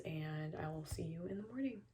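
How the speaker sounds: soft bedtime whisper; hushed sign-off. Real, not acted. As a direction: whisper goodnight so nobody wakes.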